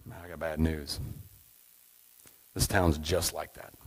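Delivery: low, slow, knowing reply; calm pastor voice. Acted. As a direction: deliver the verdict like a calm, wise pastor. Pastor answers the couple.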